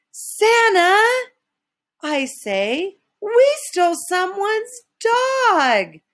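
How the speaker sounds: high, urgent confession; panicked. Acted. Elf panics telling Santa about the stolen dog. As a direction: blurt it out in alarm.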